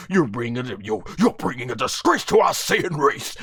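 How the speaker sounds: low, scornful proud warrior voice; Vegeta roasting. Retold or imitated. Voicing Vegeta scolding Goku, low scornful rebuke. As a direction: sneer it down at him like a prince ashamed of a soldier. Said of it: "Vegeta would be roasting this man".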